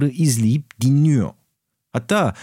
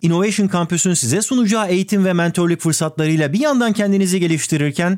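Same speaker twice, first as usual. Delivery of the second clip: ad read; 6 semitones higher, faster than usual. Acted. Reads sponsor ad.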